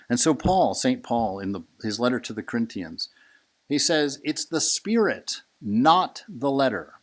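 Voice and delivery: preaching at full force. Acted. A teacher preaches Saint Paul to the room, booming. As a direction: proclaim it like a fiery preacher.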